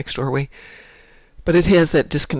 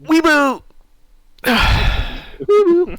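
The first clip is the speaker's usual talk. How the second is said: high squeal; 14 semitones higher, 6 dB louder, much slower than usual. Acted. Excited squeal at game creature.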